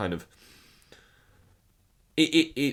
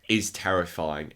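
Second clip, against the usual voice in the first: usual speaking voice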